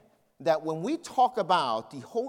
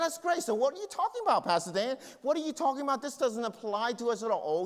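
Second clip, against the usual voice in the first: high-pitched, fast sceptical challenge; mocking objector; 6 semitones higher, faster than usual. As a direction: play a scoffing church member, pitched high. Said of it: "He said".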